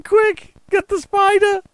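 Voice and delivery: shrill mock shriek, high and loud; imitated shouting. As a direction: mimic a wife yelling in a spider panic. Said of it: "She's shouting".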